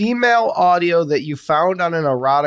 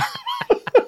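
very high-pitched laugh. Real, not acted. Cohost amused by guest at the camera, high laugh.

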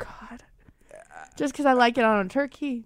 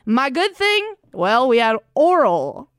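cowboy voice; 5 dB louder, much slower than usual. Shown as a demonstration. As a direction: ham up a Texan cowboy, stretching every word. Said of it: "I'll give you an example".

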